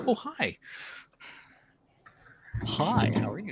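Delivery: bright high-pitched hello; high chirpy greeting. Retold or imitated. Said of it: "all of a sudden it's like".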